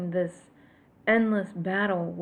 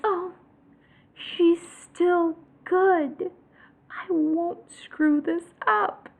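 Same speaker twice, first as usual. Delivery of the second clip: naive hope; 8 semitones higher, 4 dB louder, much slower than usual. Retold or imitated. Retelling her naive hope.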